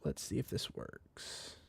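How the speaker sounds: soft unvoiced test whisper; whispered effect test. Shown as a demonstration. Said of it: "Stuff like this".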